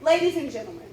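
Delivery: loud, dramatic courtroom oratory; sway the jury with each imagine; grand rich voice. Acted. Formal opening statement to jury.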